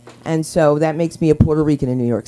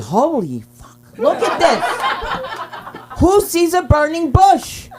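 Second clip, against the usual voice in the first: high, slow, laughing amazement; laughing disbelief; 10 semitones higher, 3 dB louder, much slower than usual. Retold or imitated. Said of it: "I said".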